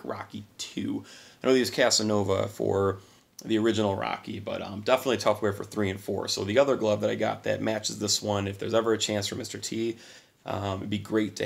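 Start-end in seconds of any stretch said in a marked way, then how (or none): none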